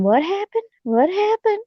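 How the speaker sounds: high, puzzled questioning; puzzled staff. Retold or imitated. ER staff quizzing the injured couple, high puzzled voice. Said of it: "They kept saying".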